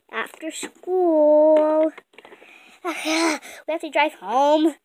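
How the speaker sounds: drawn-out, slow scene line; slow announcing. Acted. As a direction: stretch the words out slowly. Slow announcement after school.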